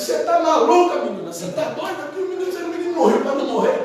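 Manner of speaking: mother scolding her daughter in disbelief; Joquebed scolds Miriam for bringing the baby home. Acted. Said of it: "Joquebed diz".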